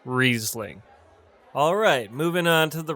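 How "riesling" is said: Riesling said REEZ-ling; long ee in Riesling, falling at the end; model pronunciation. Shown as a demonstration. Teacher models a wine name, careful pronunciation. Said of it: "It's pronounced".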